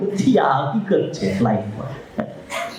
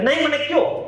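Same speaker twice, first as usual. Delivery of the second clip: put-on much higher, insistent child's voice; young boy pleading; 11 semitones higher than usual. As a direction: plead like a small boy who won't give up. (દીકરાનો ખૂબ ઊંચો, જિદ્દી આજીજીભર્યો અવાજ)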